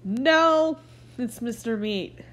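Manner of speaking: ordinary talk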